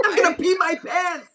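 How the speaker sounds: high, loud laughing squeal; laughing mock shriek; mock excited squeal. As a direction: squeal it like a giddy announcer. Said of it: "Didn't she say that?".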